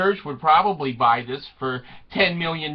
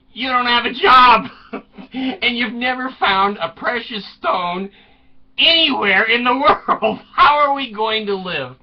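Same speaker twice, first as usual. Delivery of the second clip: high, loud comic nagging; mock exasperated scolding; 4 semitones higher, 4 dB louder than usual. Wife to jobless husband about money, mock exasperated scolding. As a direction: scold him in playful exasperation.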